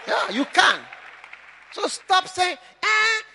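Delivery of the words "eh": higher, whiny mock complainer; mock them like a whining baby; mocking the congregation's complaints as babyish. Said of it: "stop talking like a baby".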